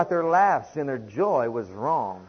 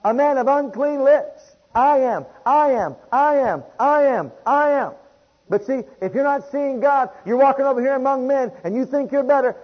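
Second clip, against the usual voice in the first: full-force preaching; 7 semitones higher, 8 dB louder than usual. Acted. Preacher thundering at his congregation about humility, loud and high. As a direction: preach it out over the whole congregation.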